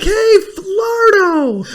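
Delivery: very high, long drawn-out holler; Tommy Boy. Retold or imitated. Host quotes the movie to co-host, high comic holler. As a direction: holler it in a hammed-up movie imitation. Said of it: "You remember Tommy Boy's like".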